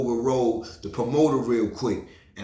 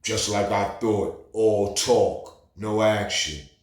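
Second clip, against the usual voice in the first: slow, dismissive tough-guy voice; imitating the bouncer; 3 semitones lower, much slower than usual. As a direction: drop it slowly like you're unimpressed. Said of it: "he was like".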